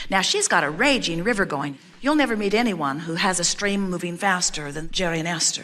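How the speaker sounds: usual speaking voice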